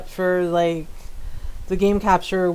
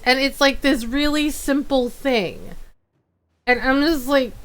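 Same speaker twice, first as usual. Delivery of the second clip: ordinary talk, nothing marked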